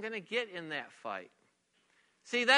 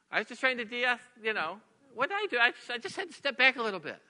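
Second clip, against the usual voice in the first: higher, flustered excuse; defensive protest; 5 semitones higher, faster than usual. Retold or imitated. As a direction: plead innocence to an upset wife. Bewildered husband defends himself, retold.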